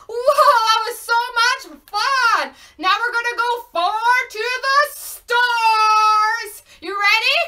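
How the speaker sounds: high, loud, thrilled host voice; excited hype. Pretend. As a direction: cheer them on to the next trip. Hyping children after pretend launch.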